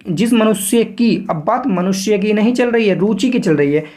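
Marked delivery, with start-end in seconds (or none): 0.1-1.1 slow, measured dictation pace; spacing the words for students to write; dictation reading. Shown as a demonstration. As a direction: read it out slowly like a dictation. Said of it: "यहाँ पर पढ़ेंगे".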